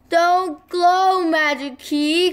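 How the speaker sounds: high, loud plea; pleading cry. Acted. Biff begging the magic key to wait, crying out. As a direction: beg the key to stop. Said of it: "cried Biff".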